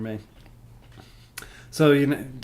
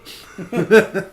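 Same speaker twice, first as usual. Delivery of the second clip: laugh; 8 semitones higher, 10 dB louder than usual. Real, not acted. Laughing at own joke.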